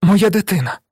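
high-pitched anguished cry; desperate, startled exclamation. A narrator's reading. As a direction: cry out for your child, voice leaping up. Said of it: "скрикнула вона".

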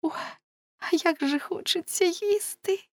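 very high, thin-voiced girl's complaint; plaintive exclamation. A narrator's reading. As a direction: whimper it high, longing for food. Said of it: "вигукнула Неле".

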